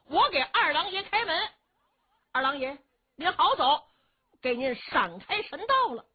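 high-pitched servant's announcement; ceremonial calling out. Acted. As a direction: call out to send off a god. Servant calls out to the god.

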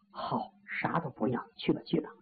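whispered, groaning dismissal; faked weak groan. Wounded general waves the soldiers away, whispered groan. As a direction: breathe it out like a man in pain. Luo Lei feigning pain, sends soldiers.

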